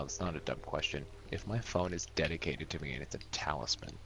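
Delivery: normal talking voice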